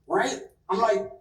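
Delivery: ordinary talk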